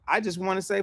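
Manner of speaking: ordinary delivery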